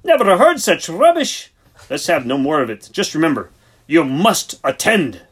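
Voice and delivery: slap the idea down with outrage; indignant outburst. A narrator's reading. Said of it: "barked Siegfried, utterly astonished".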